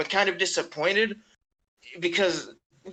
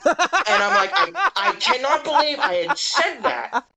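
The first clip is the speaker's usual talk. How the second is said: high, loud laughing disbelief; 8 semitones higher, 4 dB louder than usual. Real, not acted. Telling friend about his sus slip, laughing.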